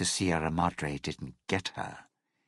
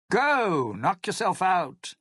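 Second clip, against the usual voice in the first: sarcastic; 12 semitones higher, 4 dB louder than usual. Acted. Dismissive brush-off.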